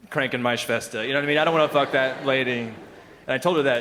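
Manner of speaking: ordinary talk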